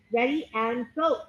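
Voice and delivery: ordinary talk, nothing marked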